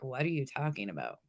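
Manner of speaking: low, soft mock-groggy reply; imitating half-asleep husband. Retelling the husband's answer about the plumber, mock half-asleep. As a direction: play a man barely awake, lightly. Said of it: "completely not even awake".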